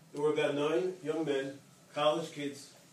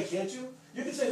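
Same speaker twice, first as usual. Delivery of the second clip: normal talking voice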